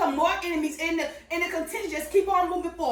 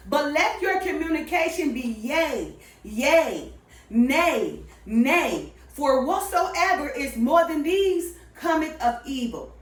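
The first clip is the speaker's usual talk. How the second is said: slow, measured Bible reading; deliberate King James recitation; scripture reading; 3 semitones lower, slower than usual. Acted. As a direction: read the verse slowly and solemnly.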